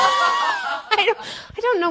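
high giggling laugh; laughing through the words. Real, not acted. Laughing fit after quail joke.

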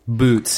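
"Boots." with the vowel of boot, Canadian style; "Boots." mock Canadian vowel. Shown as a demonstration. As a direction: say "Boots." like a Canadian saying boats. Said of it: "he calls them boots".

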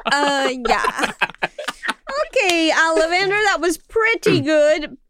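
usual speaking voice

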